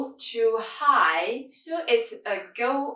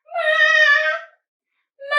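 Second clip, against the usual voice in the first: MA called out high and level; screamed first tone; 8 dB louder than usual. Shown as a demonstration. Teacher shows beginners how high, MA like a level scream. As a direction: hold each MA high, as if calling out. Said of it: "it's screaming".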